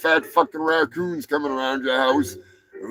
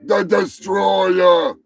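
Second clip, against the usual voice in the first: fight announcer; 4 semitones higher, much slower than usual. Pretend. Host announcing penguin against hippo, fight announcer. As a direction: hype the bout, stretching out the names.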